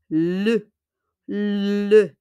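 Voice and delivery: "le" with a rounded "uh", never "lay"; unaccented French e. Shown as a demonstration. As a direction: hold the L, then a short "uh" in "le". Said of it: "we pronounce it as e".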